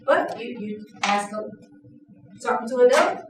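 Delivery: ordinary talk, nothing marked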